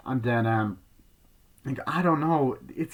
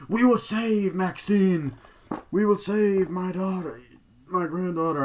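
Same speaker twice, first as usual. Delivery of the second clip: mock proclamation; 8 semitones higher, 4 dB louder than usual. Man on TV vowing to save his daughter, mock proclamation. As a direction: declaim it like a solemn public vow.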